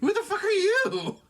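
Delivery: loud, high, drawn-out mock yell; mock British outburst. Friend teasing his bandmate about slipping into British, mock outburst. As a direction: shout it in exaggerated disbelief. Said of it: "when you slip into it".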